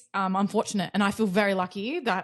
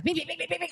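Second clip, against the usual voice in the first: rapid high-pitched phone pings; phone notification imitation; 7 semitones higher, 5 dB quieter, faster than usual. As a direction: imitate a flood of incoming alerts. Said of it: "your phone's like".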